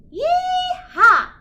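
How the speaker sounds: high, loud cowgirl whoop; gleeful yee-haw shout; joyful cheer. A narrator's reading. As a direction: whoop it out to spur the horse on. Girl cheering on her horse.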